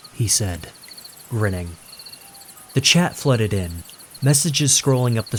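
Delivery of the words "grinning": plain reading voice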